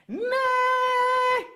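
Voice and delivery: high loud whinny; horse neigh. Acted. Horse laughing at the donkey, loud high neigh. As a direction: let out a big horse neigh. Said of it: "the horse began".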